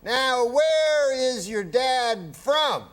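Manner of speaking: high, very slow Cosby drawl; Bill Cosby impression. Guest doing Cosby for the host, high and slow. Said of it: "And he goes".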